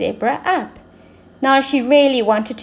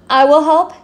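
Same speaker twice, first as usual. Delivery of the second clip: higher, louder eager offer; helpful queen; 5 semitones higher, 7 dB louder than usual. Acted. The Queen offering to help the zebra, higher louder eager voice. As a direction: jump in to help. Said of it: "said the Queen".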